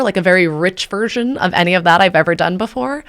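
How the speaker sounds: ordinary delivery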